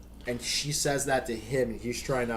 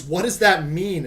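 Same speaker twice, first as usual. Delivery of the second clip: exasperated teacher; 6 semitones higher, 9 dB louder than usual. Retold or imitated.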